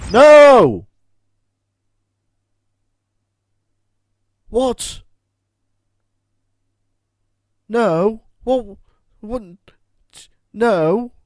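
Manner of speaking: high, loud disbelieving yells; shocked shout. Real, not acted. Player shocked the villagers attacked, yelling out. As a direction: cry out as the fight breaks out by surprise.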